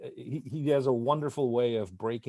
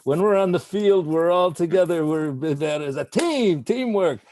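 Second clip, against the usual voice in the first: loud, raised-pitch pep talk; punchy mock speech about teamwork; acted team speech; 5 semitones higher, 6 dB louder than usual. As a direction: imitate a man rallying his team, voice pushed up. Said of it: "he's using the analogy of a baseball team".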